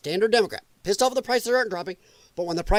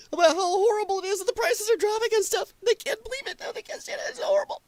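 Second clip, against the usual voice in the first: high, mocking whiny voice; mocking whine; 12 semitones higher, faster than usual. Vlogger mocking Reddit posters, high mocking whine. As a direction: whine it like a crybaby. Mocking lefty whining about prices.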